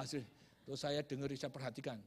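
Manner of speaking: normal talking voice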